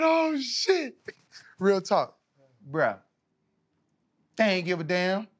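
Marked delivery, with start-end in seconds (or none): 0.0-0.9 "Oh, shit." thrown out high and sharp; re-live the moment the heart dropped; high startled Oh, shit; retold shock. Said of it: "I said".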